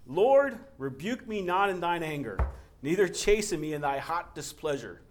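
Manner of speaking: loud, high pleading Psalm read; fervent scripture reading. Acted. Pastor reading Psalm to congregation, loud fervent reading. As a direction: cry it out like a desperate psalmist. Reads Psalm six aloud.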